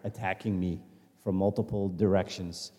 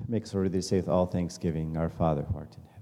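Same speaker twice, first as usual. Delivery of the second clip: measured, reverent prayer voice; leading prayer; 4 semitones lower than usual. Real, not acted. Speaker leading the group in the Lord's Prayer, reverent. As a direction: lead the room gently into the prayer.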